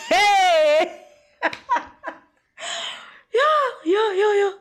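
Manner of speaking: high shrieking laughter; laughing hard. Real, not acted. Vlogger cracking up at the ancestors line. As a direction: crack up helplessly.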